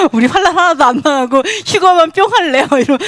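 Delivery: ordinary delivery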